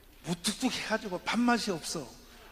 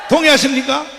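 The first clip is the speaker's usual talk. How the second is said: much louder, rising demand for agreement; shouted question to crowd; 4 semitones higher, 13 dB louder than usual. Real, not acted. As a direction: shout it again, pushing for a yes.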